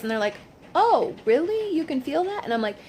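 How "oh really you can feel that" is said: higher, mock-surprised questioning; retold surprise. Surgical staff checking what the patient feels, surprised. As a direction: act out a surprised double-check.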